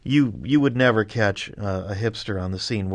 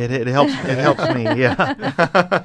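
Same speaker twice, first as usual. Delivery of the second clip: laughing; 5 semitones higher, 6 dB louder, slower than usual. Real, not acted. Laughing at the robber joke.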